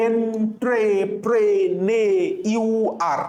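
এন্ট্রেপ্রেনিউর very slow, each syllable drawn out; long, even beats through এন্ট্রেপ্রেনিউর; broken into syllables. Shown as a demonstration. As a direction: chant এন্ট্রেপ্রেনিউর as en-tre-pre-ne-u-ar. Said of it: "আমরা এইভাবে ভাঙবো".

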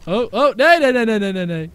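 frantic loud no no no; panicked shouting. Real, not acted. Dragged into cage panic.

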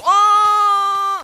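long, loud, high scream; falling scream. Pretend. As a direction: scream as you drop. Falls during parkour. (düşerken çığlık)

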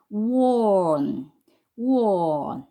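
원 as one long drawn-out syllable; pronunciation demo. Shown as a demonstration. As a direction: stretch 원 slowly as a model reading. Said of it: "정확하게 읽으면 어떤 소리가 난다".

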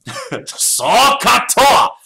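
high, loud drawn-out battle yell; samurai war cry. Pretend. Teacher demonstrates a samurai war cry to the class: loud drawn-out yell. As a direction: shout it like a samurai charging. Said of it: "say that really loud like a samurai warrior".

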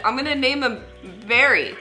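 ordinary talk

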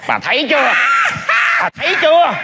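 high-pitched, rising "told you so"; triumphant insistence. Acted. Fortune teller triumphant as client cries.

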